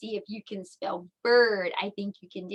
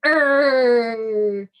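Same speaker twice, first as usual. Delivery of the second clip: loud, drawn-out "err" growl; bear growl; 7 dB louder than usual. Acted. Teacher showing what a bear says, playful growl. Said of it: "What does a bear say?".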